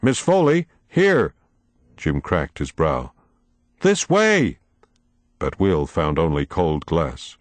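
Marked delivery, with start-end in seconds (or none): 0.0-1.3 high call across the maze. A narrator's reading. Calling into mirror maze. 3.8-4.6 high call across the maze. A narrator's reading. Calling into mirror maze.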